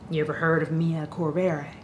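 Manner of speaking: low, hushed storyteller at the bar; smoky-voiced barfly sharing a secret; smoky tavern stranger. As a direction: play a mysterious drunk in a tavern, quiet and low. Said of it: "Why is your voice so smoky".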